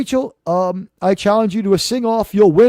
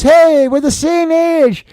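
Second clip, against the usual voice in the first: higher, slow excited exclamation; 7 semitones higher, 4 dB louder, slower than usual. Real, not acted. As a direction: gush it out.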